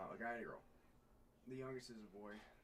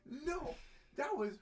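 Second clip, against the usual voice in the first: put-on high, loud, slow kid's wail, laughing; hammed-up crying kid complaining; mock crying kid; 12 semitones higher, 10 dB louder, much slower than usual. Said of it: "starts crying".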